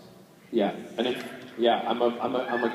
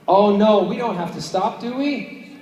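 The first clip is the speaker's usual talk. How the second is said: mock dismay; 7 semitones higher, slower than usual. Speaker protests to host about having to stop, mock dismay. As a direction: play up the alarm, rising into a pleading question.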